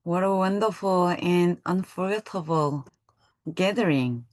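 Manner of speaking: ordinary talk, nothing marked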